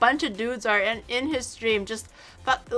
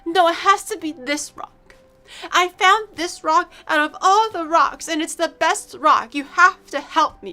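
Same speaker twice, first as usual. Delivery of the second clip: pleading friend; 6 semitones higher, 4 dB louder than usual. Retold or imitated. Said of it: "he was like".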